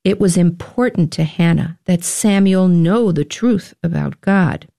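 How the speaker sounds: ordinary delivery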